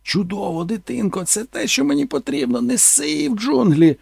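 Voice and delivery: high eager shouted praise; excited director shouting. Retold or imitated. Director waving and yelling to keep going, high excited. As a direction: egg him on with glee. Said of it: "і кричав".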